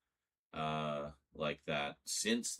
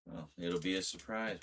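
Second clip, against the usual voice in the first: usual speaking voice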